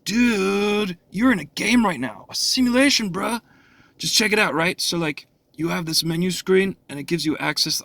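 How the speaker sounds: parodying a dialogue he finds silly; mock surfer dude. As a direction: lightly sneer the surfer-dude lines. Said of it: "not too many people talk like this".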